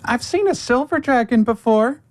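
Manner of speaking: put-on high little girl voice. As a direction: pitch it up like a small girl. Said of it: "the little girl says".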